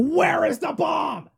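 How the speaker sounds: high, slow mock shout of Where is the bomb; Kiefer Sutherland yell. Fan imitating Kiefer Sutherland interrogating, a slow high shout. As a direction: bellow it like an interrogating TV agent. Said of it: "hearing Kiefer Sutherland yell at people".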